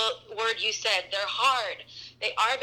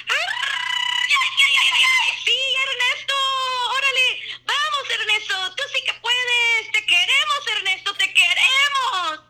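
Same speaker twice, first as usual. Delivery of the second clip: high, loud fan screaming; 5 dB louder than usual. Pretend. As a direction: scream for the star on stage. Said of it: "I'm gonna try not to scream".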